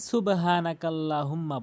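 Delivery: সুবহানাকাল্লাহুম্মা run through with short vowels; common mispronunciation. Shown as a demonstration. Teacher showing most people's mistake, সুবহানাকাল্লাহুম্মা unstretched. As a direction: say সুবহানাকাল্লাহুম্মা plainly, without elongation or held humming. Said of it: "অধিকাংশ লোক যে ভুলটি করে".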